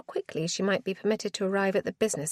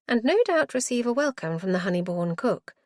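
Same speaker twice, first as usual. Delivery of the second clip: ordinary delivery, nothing marked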